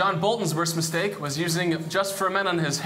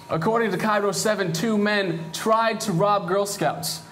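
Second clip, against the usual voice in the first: ordinary delivery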